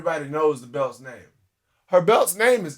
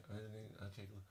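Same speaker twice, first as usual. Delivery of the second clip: low words squeezed through clenched teeth; through gritted teeth; 7 semitones lower, 23 dB quieter than usual. Retold or imitated. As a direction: hiss it through tight teeth. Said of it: "speak through their teeth".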